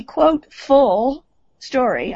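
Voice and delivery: "full" drawn out slowly and set apart; stress on full. Real, not acted. Speaker underlines the word for the audience, stress on "full". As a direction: lean hard and long on "full". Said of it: "I underline the word full".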